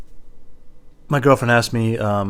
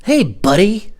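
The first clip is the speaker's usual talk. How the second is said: high, loud patronizing call; mock chummy condescension; condescending; 10 semitones higher, 6 dB louder, slower than usual. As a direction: greet him like a little kid, high and loud. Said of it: "that buddy thing".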